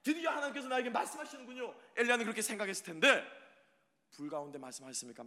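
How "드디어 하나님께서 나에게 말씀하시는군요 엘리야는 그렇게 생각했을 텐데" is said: high, loud, fast preaching; preaching at full force. Acted. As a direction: drive it home with fire. Preacher builds to climax.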